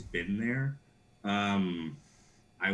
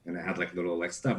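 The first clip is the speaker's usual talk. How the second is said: normal talking voice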